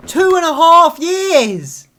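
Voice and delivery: loud, slow, outraged shout; real anger. Real, not acted. Fan ranting at the show, furious shout. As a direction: bellow the wait time in disbelief. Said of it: "I'm so angry".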